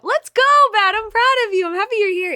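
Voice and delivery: high, loud cheer for a friend; loud high-pitched celebration; excited cheering. Real, not acted. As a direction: hype them up with joy. Cheering a viewer.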